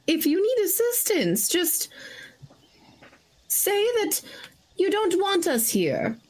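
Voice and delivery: sweet halfling voice. Acted. Halfling speaks gently to NPC.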